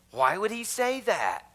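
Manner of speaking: a touch of indignant disbelief; mock shocked question. Preacher voicing the congregation's shock at Jesus, a mock baffled question.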